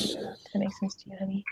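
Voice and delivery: soft whispered aside; whispering. Real, not acted. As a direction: whisper it privately to someone close. Whispered aside to someone nearby.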